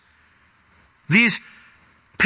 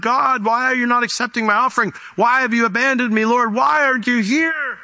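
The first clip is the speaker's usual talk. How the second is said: higher-pitched, hammed-up pleading cry to God; mock weeping plea; 9 semitones higher than usual. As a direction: act out a worshipper's tearful complaint, pitched up. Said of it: "they're weeping and they're crying".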